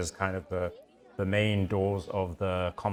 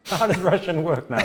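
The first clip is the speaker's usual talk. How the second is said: high, loud slurred yell; drunken Malachus; 8 semitones higher, 7 dB louder than usual. Acted. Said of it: "He was kind of talking like that".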